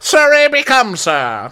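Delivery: high, slow theatrical voice; hammed-up haughty title reveal; grand movie title. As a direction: announce it with drawn-out mock grandeur. Joke movie titles in Karen's voice.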